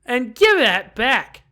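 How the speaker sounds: loud, slow demand. Acted. Embarrassed girl demanding her poem back, loud. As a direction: snap it out, wanting the poem back.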